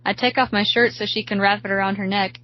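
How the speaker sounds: plain reading voice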